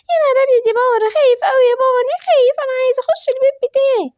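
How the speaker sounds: put-on a little boy whimpering to his dad; scared little boy. Little Simba tells his father he is scared, in a high child voice.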